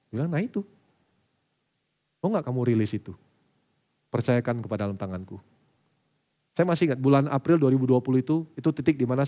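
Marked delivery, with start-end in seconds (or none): 0.3-5.4 tender voice of God. Retold or imitated. God's voice, soft and low.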